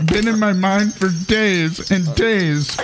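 poem recital. Acted. Comic reading a Christmas poem to co-hosts, mock-solemn recital. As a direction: recite it like a sacred holiday verse.

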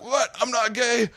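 high-pitched mock panic; imitating a man freaking out; freaked-out denial. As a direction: blurt it out in alarm, voice jumping up. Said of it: "like, freaked out".

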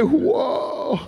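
high, drawn-out whoa of disbelief; imitated amazed whoa. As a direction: show how the fan gaped in wonder. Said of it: "that's what he did".

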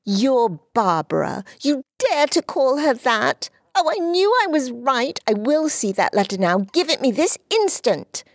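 put-on high, commanding girlish voice; high imperious child's demand; imperious little girl. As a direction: order him like a little queen. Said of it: "said Dulcie imperiously".

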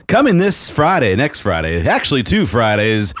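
high, hyped announcer read; promo voice. Pretend. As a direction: sell it like a radio ad spot. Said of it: "put on your best promo voice".

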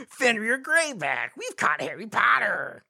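resentful werewolf. Acted. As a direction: play a resentful werewolf, slowly. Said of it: "There was resentment in the werewolf's voice".

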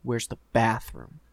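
slow, heavy, menacing "Where's the bathroom?"; menacing ask. Retold or imitated. Ripped stranger mean-mugging him, slow menacing "Where's the bathroom?". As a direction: make "Where's the bathroom?" sound like a threat, slow. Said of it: "mean mugging me".

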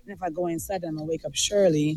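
hushed so nobody wakes; quiet. Real, not acted. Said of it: "So I'm trying to be quiet".

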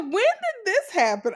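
shocked. Retold or imitated. Friend seeing the timeline post, squealing in shock. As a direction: squeal it out in disbelief. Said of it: "I was so shocked".

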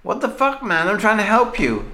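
high, exasperated yelp; shocked and offended; indignant outburst. Acted. Voicing cop's outburst.

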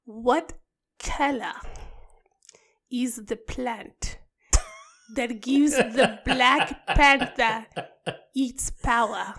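slow, halting mock Wakandan accent; Wakanda accent. Host teasing co-host with a quiz question, in a Wakanda accent. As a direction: put on a Forrest Whitaker Wakandan accent, word by word. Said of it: "That's my Wakanda accent".

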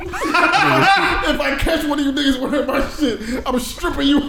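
high mock threat through laughter; laughing mock threat. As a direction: crack up while imitating a slow threat. Retelling dorm threat, cracking up.

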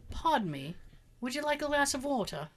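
lightly put-on British stewardess, soft and polite. Stewardess offering water to aunt, mild British accent. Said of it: "she goes".

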